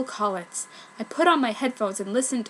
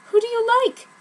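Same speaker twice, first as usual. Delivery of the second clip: bright, higher and louder question; happy question; 12 semitones higher, 5 dB louder than usual. Acted. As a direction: perk up, eager to know. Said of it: "said Ichigo happily".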